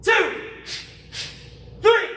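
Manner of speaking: loud, high drill count; barked martial arts count. Real, not acted. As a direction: call the count to the class. Instructor counting drill aloud.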